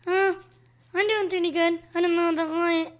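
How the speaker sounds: high, loud puppet voice; squeaky ventriloquist dummy. Acted. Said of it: "his ventriloquist dummy, James".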